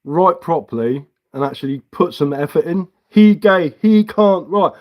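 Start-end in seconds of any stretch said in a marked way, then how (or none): 3.1-4.7 higher, louder dumb caveman mockery; higher, louder caveman taunt. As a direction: bellow like a dim caveman. Mocking the chatter as caveman.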